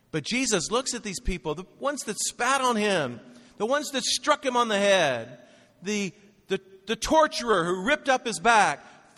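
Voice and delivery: high, loud sermon delivery; impassioned preaching. Real, not acted. Preacher to congregation on forgiveness at the cross, impassioned.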